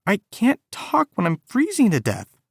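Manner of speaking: high, teary whimper. A narrator's reading. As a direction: whimper as if about to cry. Whimpering on, near tears.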